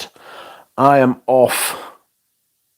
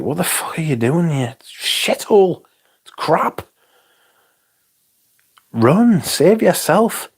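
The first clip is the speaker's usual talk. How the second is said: hammed-up warning to tourists; mock exasperated local; 7 semitones higher than usual. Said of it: "you're like".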